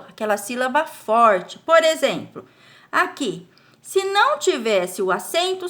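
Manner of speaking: normal talking voice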